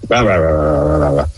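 mock ranting. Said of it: "Gareth Barry's giving me".

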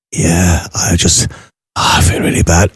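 low, feeble put-on invalid; faked sick voice. Employee telling the boss he is sick, faked sick voice. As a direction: imitate someone badly faking illness, voice dropped. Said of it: "Why are you faking your voice?".

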